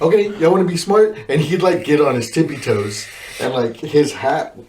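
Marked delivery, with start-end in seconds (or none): 0.0-1.2 high, fast taunting challenge; mock J-hat. J-hat to recruits, high fast threat. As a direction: challenge them in a raised instructor voice. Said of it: "whenever he was like".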